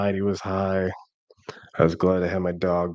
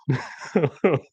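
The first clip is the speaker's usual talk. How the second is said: amused laugh; 10 semitones higher than usual. Real, not acted. Laughs at own joke.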